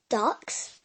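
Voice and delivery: ordinary delivery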